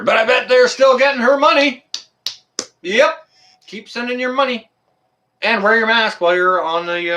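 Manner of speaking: high, heated jab; mock the college bitterly; sarcastic rant about campus fees; angry sarcasm. Real, not acted.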